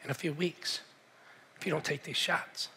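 grim quiet warning. Retold or imitated. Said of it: "He says".

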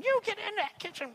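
high-pitched bossy squawk; hammed-up scolding in a put-on high voice; scolding Martha. Acting out Martha waving a spoon.

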